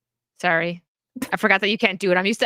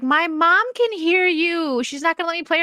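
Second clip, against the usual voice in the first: put-on little kid; 9 semitones higher than usual. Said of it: "some little kid was on there".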